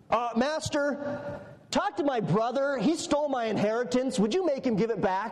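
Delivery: whining petitioner. Acted. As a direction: pipe up with a petty grievance. That guy interrupts Jesus with petty complaint.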